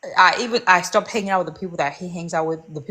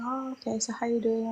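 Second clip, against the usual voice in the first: softer, higher, imitating an uneasy greeting; mock polite, hushed awkward hello; awkward small talk; 4 semitones higher, 8 dB quieter than usual. Ex greeting her after years, awkward and softly higher. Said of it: "it was so awkward".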